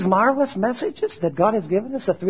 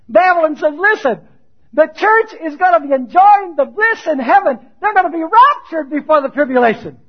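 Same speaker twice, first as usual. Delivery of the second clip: loud, high-pitched pulpit delivery; forceful preaching; 7 semitones higher, 7 dB louder than usual. Acted. Preacher voicing what Babylon teaches the church, forceful. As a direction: drive the quote out to the congregation.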